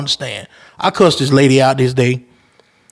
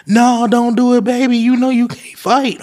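put-on high girlfriend voice; 8 semitones higher than usual. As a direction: plead like a worried girlfriend. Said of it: "She was like".

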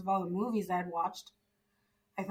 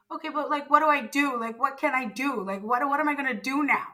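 panicked pleading; 4 semitones higher, 6 dB louder than usual. Retold or imitated. Retold panic in birthing facility.

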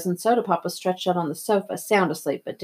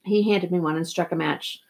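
ordinary delivery

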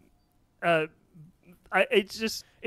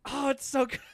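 high, gushing reaction; overcome fan; 8 semitones higher than usual. Retold or imitated. Fan reacting to the Remember Me scene, high and gushing. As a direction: gush as if the film just undid you.

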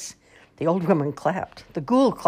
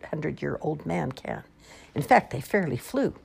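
usual speaking voice

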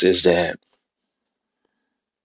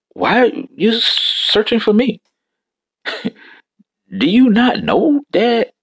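put-on boy Jesus; 12 semitones higher than usual. Young Jesus voiced answering mother.

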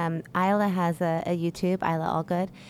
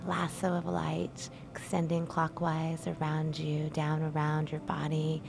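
soft, calm meditation voice with whispered lines; guided meditation; 8 dB quieter than usual. Acted. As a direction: guide them gently into stillness.

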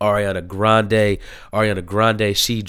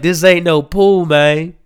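Gucci; 7 semitones higher, 6 dB louder than usual. Retold or imitated. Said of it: "he's like".

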